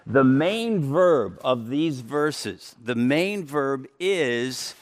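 "verb" and "main" said slowly and weighted; stress on verb. Shown as a demonstration. Preacher pointing out the key word, slow and stressed. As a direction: lean on "main verb", unhurried. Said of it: "that's the emphasis".

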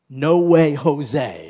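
loud punchy No way Jose; preacher's loud colloquial no; emphatic retort. Acted. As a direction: bang out the denial. Emphatic paraphrase.

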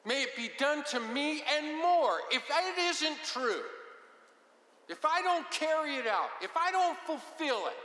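mock-solemn oath sealing his hatred; solemn vow. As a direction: swear it like your life depends on it. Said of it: "he says something like".

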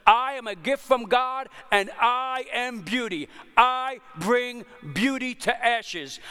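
Jesus, boldly. Acted. Jesus announcing himself to the synagogue, bold proclamation. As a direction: proclaim each "I" statement with conviction. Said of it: "Jesus walks up to the front boldly".

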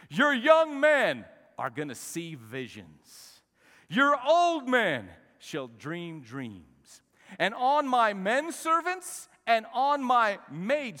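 loud, slow, drawn-out declamation; booming pulpit proclamation of scripture; preach. Acted. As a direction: call out each promise with full force. Declaims prophecy at full force.

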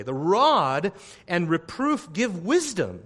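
fervent pulpit reading; preaching. Acted. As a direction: proclaim the verses to the congregation. Preaching scripture.

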